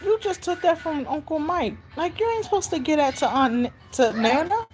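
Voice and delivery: put-on high, incredulous telling-off of a child; playful scolding. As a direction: protest like a scandalized grandma.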